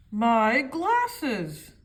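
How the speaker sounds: broken glasses, loud dismayed cry. A narrator's reading. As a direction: wail it as the glasses break. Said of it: "cried Mister Clever".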